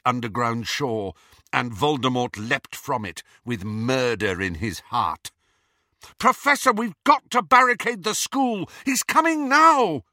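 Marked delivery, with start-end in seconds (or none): 6.2-10.0 loud, high alarmed warning; urgent shouting. A narrator's reading. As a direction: yell the warning in panic. Harry warns of Voldemort.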